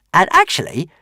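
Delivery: high, offhand creature voice; small dragon offering help casually; careless little dragon. Acted. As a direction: toss it off casually. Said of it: "said Toothless carelessly".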